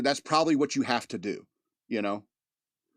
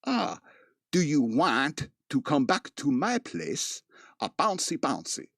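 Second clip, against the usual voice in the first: slow, mock foreign-tourist voice; drawn out like a clueless tourist; Monty Python phrasebook voice; slower than usual. Retelling the phrasebook prank in a lightly put-on tourist voice. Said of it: "Monty Python bit".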